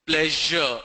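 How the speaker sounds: pleasure as PLEH-zhuh; zh example. Shown as a demonstration. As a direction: buzz the zh in pleasure. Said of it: "Z H is pronounced as".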